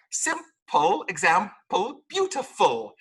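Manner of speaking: simple, example, beautiful with a clear final L; correct L. Shown as a demonstration. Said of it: "There is an L in".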